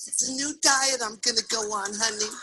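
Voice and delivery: soft, timid little answer; small placating voice, acted; meek wife. Wife explains her diet box to negative husband, meekly.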